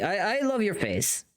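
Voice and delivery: ordinary talk, nothing marked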